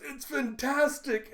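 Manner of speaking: high, drawn-out mock delight; over-the-top praise. Yuri praises Yor's awful cooking, hammed-up delight. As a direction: gush with forced enthusiasm.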